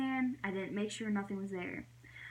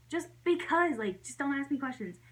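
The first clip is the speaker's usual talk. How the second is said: mock exasperated snap, higher and louder; frustrated; 5 semitones higher, 4 dB louder than usual. Snapping back at brother while driving, retold frustration. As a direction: cut off the questions in frustration. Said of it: "I was really frustrated".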